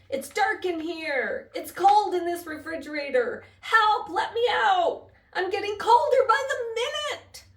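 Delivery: trapped mouse calling to be let out, loud and high. Acted. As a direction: keep shouting through the door, growing frantic. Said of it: "he called".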